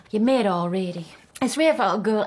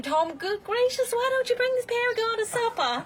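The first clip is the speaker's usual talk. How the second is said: higher, airy retelling of her own scolding; quoting herself; 12 semitones higher than usual. Retold or imitated. Mother tells the guest how she coaxed her son, in a higher voice. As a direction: replay her own coaxing. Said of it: "I finally say to him".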